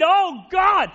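a high, loud cry of anguish; full-voiced anguished plea; cry to God. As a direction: cry out to God as the woman at his feet. Voicing the woman's cry.

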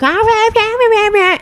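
high, far-off ranting noises; neighbor shouting. Retold or imitated. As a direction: screech like the angry neighbor. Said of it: "you hear the neighbor just like".

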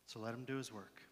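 low, soft, pausing pastoral voice; gentle ministry. Real, not acted. Pastor telling worshippers they are being drawn back, soft and low. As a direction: softly speak into a hushed room with long pauses.

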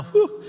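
high exhaled whew; real sigh of being worked up. Real, not acted. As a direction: blow out a breath to settle yourself. Catching himself after getting worked up.

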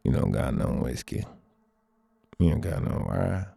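low, gruff stranger; creepy stranger. Retold or imitated. Stranger at the diner demanding whiskey, low and gruff. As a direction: growl it like a menacing drifter. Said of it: "and he's like".

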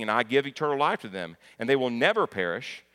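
loud, emphatic proclamation of the verse; preacher driving a verse home; forceful scripture reading. Acted. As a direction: proclaim it with full conviction.

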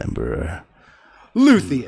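low title building to a loud name; announcer introduction. Pretend. Host unveiling the returning guest, building announcer voice.

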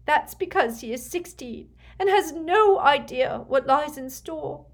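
high, distraught mother's protest; crying in earnest. A narrator's reading. Weeping mother beseeching Snape about her son, distraught. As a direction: plead through tears, voice pitched well up. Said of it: "Narcissa began to cry in earnest".

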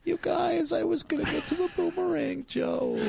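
high, plaintive whine; hammed-up high-pitched pleading; mock whining. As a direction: complain like a let-down kid. Whines about his interrupted joke.

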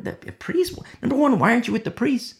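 shocked scouts questioning the priest. Retold or imitated. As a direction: blurt it out in surprise. Recon team shocked at priest.

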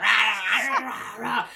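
hammed-up shouted rahs; mock battle cries. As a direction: bark cartoon fight yells. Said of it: "And then you hear".

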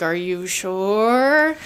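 very slow drawn-out are you sure; slow doubtful question. Retold or imitated. Yubaba testing Chihiro's choice, slow doubtful question. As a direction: stretch the doubt out slowly.

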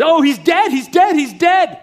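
high, loud anguished cry; mother screaming. Retold or imitated. The mother crying out over her dying boy. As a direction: wail it out in panic. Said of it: "the mother began to scream".

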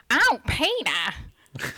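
slow, drawn-out cartoon wife impression; put-on American cartoon housewife, words dragged long; Lois Griffin. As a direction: stretch out the husband's name in a cartoon voice. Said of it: "it's Lois Griffin".

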